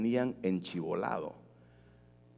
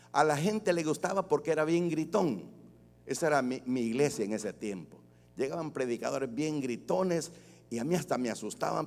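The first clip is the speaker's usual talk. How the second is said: ordinary delivery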